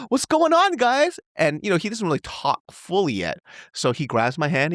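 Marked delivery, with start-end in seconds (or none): 0.1-1.2 much higher, bright call to the family; cheery dad greeting. Retold or imitated. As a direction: pop your head in playfully. Dad joining the fun.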